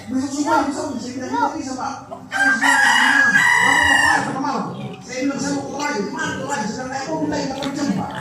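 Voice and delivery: high-pitched, urgent pleading; pleading with officer. Retold or imitated. Preacher reenacts begging the airport officer, high and urgent. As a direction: beg as if your flight depends on it.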